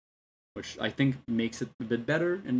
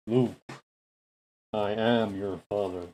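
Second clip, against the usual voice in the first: masked movie villain; 3 semitones lower, slower than usual. Pretend. Famous masked villain quote.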